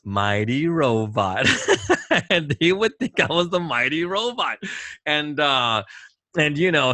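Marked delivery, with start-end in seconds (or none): 0.0-1.5 low, slow, drawn-out echo; slow, low mock-reverent naming; imitated patient. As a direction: mimic him slowly, pitched low. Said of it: "And then he goes".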